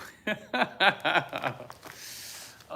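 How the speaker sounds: high, helpless burst of laughter; real laugh. Real, not acted. Preacher caught out by his own words, laughing hard.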